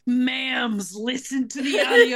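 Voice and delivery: loud, laughing plea to the ma'ams; excited plea. Retold or imitated. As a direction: beg them, half laughing.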